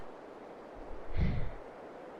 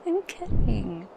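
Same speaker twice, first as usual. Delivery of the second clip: higher, drawn-out, amused aside; amused teasing; 4 semitones higher, slower than usual. Acted. Dragoness admitting she teased her passenger, amused. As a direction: slowly let them in on the joke.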